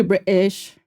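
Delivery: British glottal stop in British; British accent. Pretend. Streamer wishing to be British, playful British accent. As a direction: drop the T, British style. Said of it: "Get to say "what uh" all day".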